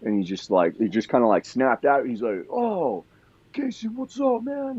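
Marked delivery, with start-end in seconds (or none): 2.5-4.8 high, bright-eyed imitation of an excited friend; excited greeting. Edwin greeting the narrator backstage, high and excited. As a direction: pitch way up like he just snapped awake.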